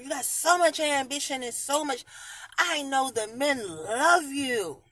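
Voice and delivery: high, loud fawning praise; imitating coworkers gushing over her; mock gushing fans. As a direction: gush like an admiring coworker. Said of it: "people at my job think".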